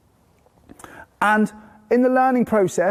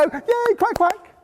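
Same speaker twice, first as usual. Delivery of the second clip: high, delighted cheer; excited praise; 12 semitones higher, slower than usual. Shown as a demonstration. Parent cheering the baby's word, high and delighted. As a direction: celebrate the baby's quack quack. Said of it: "No, I go".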